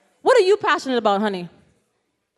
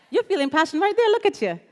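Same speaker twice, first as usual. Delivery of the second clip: usual speaking voice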